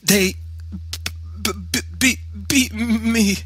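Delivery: high, stammering gasps; gasping. A narrator's reading. Beaten boy meeting mother at the door, gasping stammer. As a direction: choke out broken syllables like a sobbing, breathless boy. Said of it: "I gasped".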